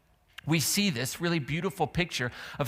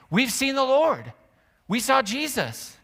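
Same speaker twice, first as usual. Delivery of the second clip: high, loud thrilled announcement; excited disciples; 8 semitones higher, 5 dB louder than usual. Retold or imitated. Disciples telling Thomas, excited and loud. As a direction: burst in with the news. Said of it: "they say".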